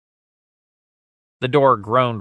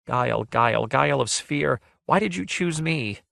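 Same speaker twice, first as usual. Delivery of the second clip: mild soft lament, rising higher; soft groan; 5 semitones higher than usual. A narrator's reading. Shirl asks Guile why he chose her, soft groaning lament. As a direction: plead gently, as a lightly voiced maiden. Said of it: "Shirl groaned softly".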